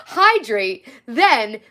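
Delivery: cracking up mid-read; laughing. Real, not acted. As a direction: giggle through it. Laughing while reading.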